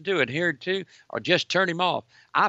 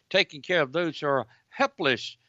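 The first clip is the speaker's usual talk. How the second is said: ordinary talk, nothing marked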